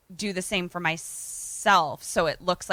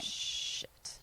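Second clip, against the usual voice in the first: hushed, drawn-out whisper; whispered dismay; 15 dB quieter than usual. Real, not acted. Listener hearing time is out, whispered curse. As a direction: whisper it under the breath.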